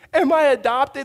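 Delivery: put-on high boy asking his mom; crying little brother. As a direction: blurt it like an upset boy. Crying brother voiced in story.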